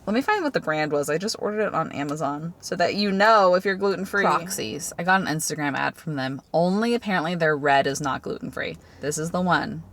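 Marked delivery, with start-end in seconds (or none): none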